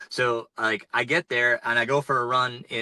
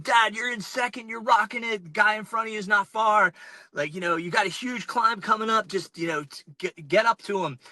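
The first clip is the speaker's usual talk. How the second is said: much higher, imitating his excited kids; mock child voice, pitched up; 10 semitones higher than usual. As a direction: play the kids hyping up their dad. His kids cheering him on.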